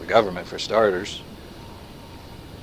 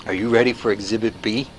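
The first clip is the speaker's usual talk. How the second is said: usual speaking voice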